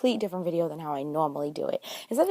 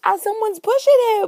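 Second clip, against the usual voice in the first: much higher and louder, delighted; excited laughter; 14 semitones higher, 8 dB louder than usual. Real, not acted. Telling viewers about Dopey getting pushed, high loud delighted voice. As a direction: crack up telling the best bit. Excited retelling of funny scene.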